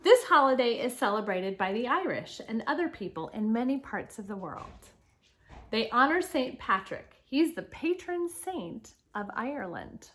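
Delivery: projected, lilting read-aloud with punched openings; reading to children. Acted. Teacher reads Saint Patrick's life story to children, animated read-aloud. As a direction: read clearly to the class, lifting key words.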